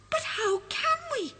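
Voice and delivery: high, breathless little bear; gasping question. Acted. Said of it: "gasps Rupert".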